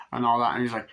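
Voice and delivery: ordinary talk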